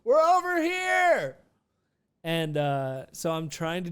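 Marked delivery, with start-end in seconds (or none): 0.0-1.4 high-pitched mock call-out, last words stretched; calling friends over. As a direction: re-enact hailing friends from across a lot. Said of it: "trying to wave my friends down".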